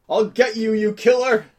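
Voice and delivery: high, impassioned threat; high-pitched theatrical outrage; vengeful cry. Acted. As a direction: cry out a vow of revenge. Vowing revenge on the killer.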